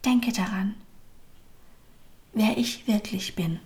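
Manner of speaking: very still. A narrator's reading. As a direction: let it sink to a hush. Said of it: "wurde noch stiller". (ganz still und leise geflüstert)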